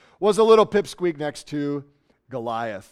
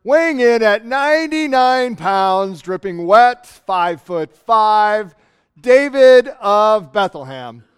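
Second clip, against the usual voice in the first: slow, louder ring announcer call; boxing announcer; 3 semitones higher, 6 dB louder than usual. Retold or imitated. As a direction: announce the underdog with mock grandeur. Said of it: "then the announcer says".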